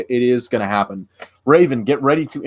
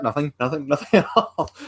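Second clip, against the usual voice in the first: high, quick-to-deny mock innocence with laughter; laughing backpedal; 4 semitones higher, 3 dB quieter than usual. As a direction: play innocent and crack up laughing. Acts backpedalling reply, breaking into laughter.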